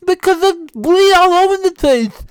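very high, loud wail with tongue hanging out; tongue-out talk. Acted. Husband panicking to wife about his bleeding tongue, high tongue-out wail. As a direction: whine thickly around a stuck-out tongue. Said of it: "I just talked like that".

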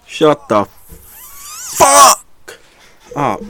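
drawn-out shout, pitch leaping high; exasperated outburst. Real, not acted. Fed-up listener cutting off an interview, exasperated shout. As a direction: yell it out slowly, fed up.

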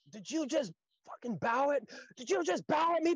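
rising to a high, loud disbelieving yell; a sergeant who can't believe what he just saw; outraged sergeant. Retold or imitated. Sergeant yelling at the recruit who bowed, disbelieving shout.

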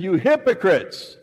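high, loud preaching rebuke; Jesus condemning the religious leaders, loud. Retold or imitated. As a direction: thunder it at the Pharisees.

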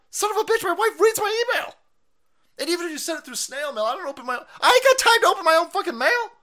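high, loud, fast ranting; loud fast tirade, pitched high; comic shouting rant. Real, not acted. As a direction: shout it out in mock outrage. Ranting about wife reading email.

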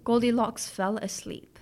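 ordinary delivery, nothing marked

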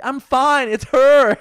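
high, loud exasperated yell; loud shout. Retold or imitated. Shouting reply to worried owner.

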